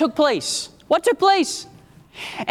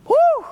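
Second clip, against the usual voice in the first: high whooping cry of praise; joyful woo. Real, not acted. Preacher whoops to his congregation, high and joyful.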